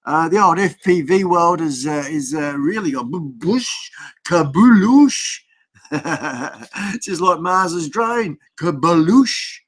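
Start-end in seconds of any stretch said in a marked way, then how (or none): 4.2-5.4 drawn-out, higher, louder kabuloosh; mock crash sound. The vlogger mimics a drone crash, slow and loud. 8.6-9.6 mock crash sound.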